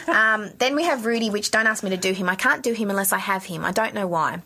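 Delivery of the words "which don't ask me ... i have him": normal talking voice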